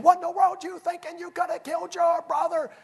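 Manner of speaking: high-pitched, scolding mom voice; imitating mom's indignant yell; mother hollering. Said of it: "She's hollering at my brother".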